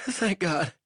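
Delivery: a weak captive fumbling for words; lightly voiced delirious prisoner; feverish prisoner. A narrator's reading. Banks voice.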